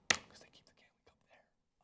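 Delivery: hushed, sneaky delivery of the joke; whispered joke ending; whispered punchline. Real, not acted. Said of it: "punchline of joke".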